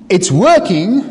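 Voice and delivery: ordinary delivery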